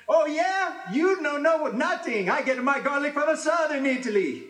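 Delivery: high, loud scornful comeback; arguing Italian. Retold or imitated. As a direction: sneer back at the rival, pitched high. Rival Italian answers back.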